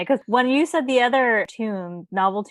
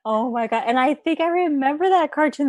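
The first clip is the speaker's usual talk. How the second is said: ordinary delivery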